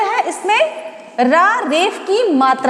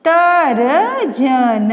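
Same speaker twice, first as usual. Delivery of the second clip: slow sounding out; 3 semitones lower, much slower than usual. Shown as a demonstration. Teacher drawing out तर्जन for young students. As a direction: stretch तर्जन very slowly. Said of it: "तो आपका हो जाएगा".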